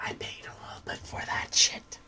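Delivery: soft unvoiced whisper aside to chat. Real, not acted. Streamer whispers to chat that he paid for the camera, hushed. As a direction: sneak it in under your breath.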